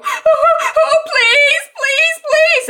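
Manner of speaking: high, loud sobbing plea; imitated hysterical crying. Katie begging for her dog, hammed-up hysterical crying. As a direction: beg through mock sobs. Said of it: "She's near hysterical".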